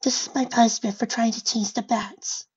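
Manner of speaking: high, small character voice; timid high-pitched resignation; meek, dejected pony. Acted. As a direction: accept the blame in a tiny high voice. Fluttershy blames herself, head hung.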